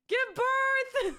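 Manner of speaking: high, loud comic yell; hammed-up outraged yell; mock shout. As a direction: shout it like a frantic coach. Mock yelling at baby-holding mom.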